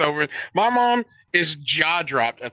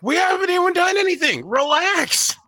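pitched up, pleading we haven't even done anything; defensive protest; 10 semitones higher than usual. Retold or imitated. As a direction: brush off mom's worry, flustered. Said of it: "And I'm like".

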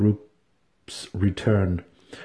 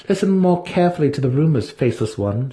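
proud priestess; 7 semitones higher than usual. Acted. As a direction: speak as a proud high priestess. Vienna's voice in the story.

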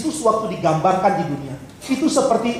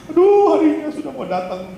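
high, wailing mock lament; hammed-up Siti Nurbaya wailing; lamenting bride; 9 semitones higher than usual. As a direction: play the bride who dreads her wedding day. Unwilling bride laments her wedding.

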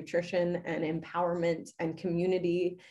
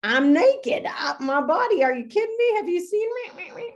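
hammed-up shocked squeal, much higher and louder; mock horrified; 12 semitones higher, 6 dB louder than usual. Mom mock-protests her child's compliment, high and shocked. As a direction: play up a scandalised disbelief. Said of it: "my first instinct was like".

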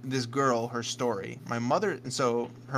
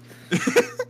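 high laugh; 7 dB louder than usual. Real, not acted.

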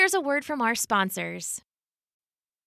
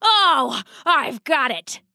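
sudden inspiration; 2 semitones higher, 3 dB louder, slower than usual. Acted. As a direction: burst out with a brainwave. Father's sudden bright idea.

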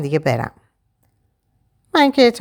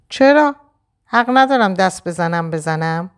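defiant challenge; 4 semitones higher than usual. Acted. Husband daring Shadi to stop him touching his wife, defiant. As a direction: throw it back at her, daring.

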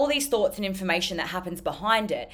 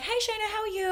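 imitating a chirpy guy; 3 dB quieter than usual. Pizza shop guy greeting her.

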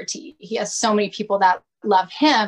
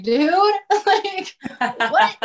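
cracking up in disbelief at the book; laughing disbelief; 11 semitones higher, slower than usual. Retold or imitated. Single mom laughing in disbelief at the sign she found.

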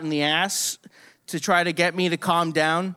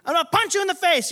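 high, fast imitation of a furious doctor; yelled threat; 13 semitones higher, faster than usual. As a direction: yell it fast as an acted threat. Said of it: "is threatening to sedate me by saying".

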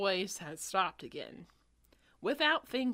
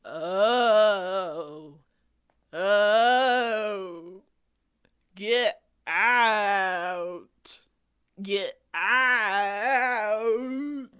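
loud moaning ghost voice; 11 dB louder, much slower than usual. Acted. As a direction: wail like a ghost. Ghost voice in story.

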